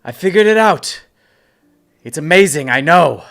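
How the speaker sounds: high, loud call from above; yelling down. Acted. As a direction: call down excitedly to someone below. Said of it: "I'm gonna yell down to him".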